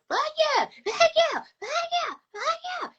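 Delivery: hammed-up cook hollering; imitating the cook's yell. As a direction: cook from the back, mock-hollered. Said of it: "gonna yell".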